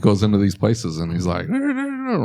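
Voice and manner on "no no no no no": high, twangy string of nos; a high-pitched country twang. Retold or imitated. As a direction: imitate the cousin's twang. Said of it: "that's the twang he hits".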